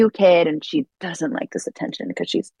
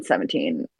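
mock-brooding vampire, much lower and softer; very dramatic; 11 semitones lower, 4 dB quieter than usual. Recapping Twilight for the podcast hosts, hammed-up dramatic low voice. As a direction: deliver it low like a melodramatic teen vampire. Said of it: "Very dramatic".

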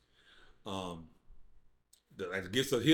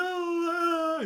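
high, louder imitation of a bawling cry; mock crying wail; 5 dB louder than usual. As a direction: wail like a man crying like a baby.

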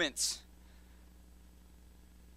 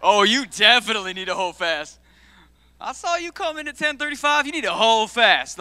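mock scolding; 4 semitones higher, 7 dB louder than usual. Mock scolding a late congregant.

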